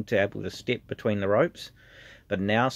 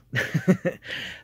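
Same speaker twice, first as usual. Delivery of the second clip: teary laugh; 7 semitones higher than usual. Real, not acted. Thanking his mentors, laughing through tears. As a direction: laugh it off, choked up a little. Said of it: "getting a bit teary-eyed now".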